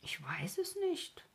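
slow, high, wondering tone; drawn-out, high-pitched bewilderment; puzzled wonder. A narrator's reading. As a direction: say it slowly, surprised at yourself. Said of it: "sagte er selbst verwundert darüber".

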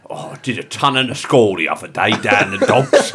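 hammed-up Cockney punter; mock London East End accent. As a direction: play a Cockney boasting at the dog track. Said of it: "They all say skull".

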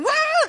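high, sudden startled yell; mock scream. Husband startled while hugging wife in bed, screaming. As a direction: imitate a shriek of fright. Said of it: "tiba-tiba dia teriak".

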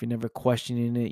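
normal talking voice

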